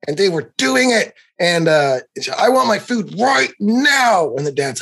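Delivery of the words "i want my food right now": put-on slow, high whiny child voice; bratty little girl. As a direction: whine it out like a spoiled four-year-old. Imitating demanding little girl.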